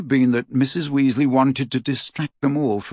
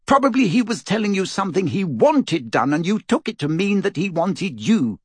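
a worried mother lecturing hard; scolding mother; 9 semitones higher than usual. Acted. Mother scolding Harry, upset.

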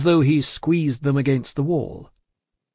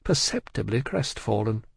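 plain reading voice

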